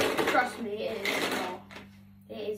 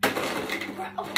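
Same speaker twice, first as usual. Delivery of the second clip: normal talking voice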